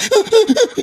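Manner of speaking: a short high burst of laughter; amused laugh. Real, not acted. Speaker laughs at his impression of his father, high laugh. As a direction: laugh at your own impression.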